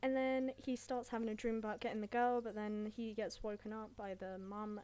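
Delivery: ordinary delivery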